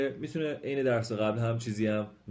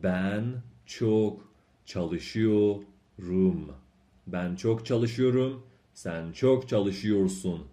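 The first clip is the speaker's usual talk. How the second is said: slow drill reading; much slower than usual. Shown as a demonstration.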